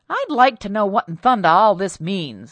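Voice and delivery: loud, exasperated demand; fed up, bursting out; angry husband. Acted.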